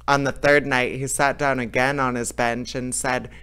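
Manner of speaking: ordinary delivery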